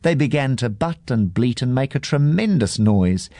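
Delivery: plain reading voice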